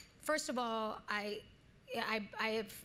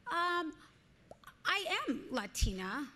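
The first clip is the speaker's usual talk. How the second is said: put-on higher, puzzled teen voice; young self; 8 semitones higher than usual. Actress voicing her confused teen self at the audition. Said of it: "and I said".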